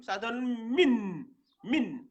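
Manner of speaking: slow, drawn-out "min"; teacher demonstrating the syllable "min"; slow syllable demo. Shown as a demonstration. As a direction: sound out "min" slowly. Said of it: "ça donne le".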